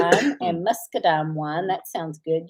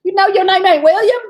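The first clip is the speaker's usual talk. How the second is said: laughing retort; 9 semitones higher, 5 dB louder than usual. Retold or imitated. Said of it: "I said".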